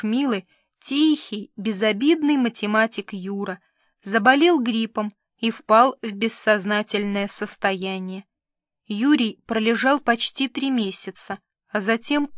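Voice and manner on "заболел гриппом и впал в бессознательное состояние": plain reading voice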